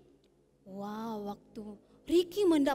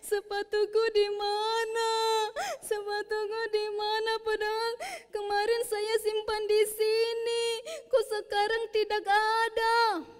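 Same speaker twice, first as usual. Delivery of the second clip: put-on higher whiny child's lament; crying; 7 semitones higher than usual. A narrator's reading. Mono cries over his lost shoes, whining. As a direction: whimper the question through tears. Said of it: "akhirnya Mono menangis".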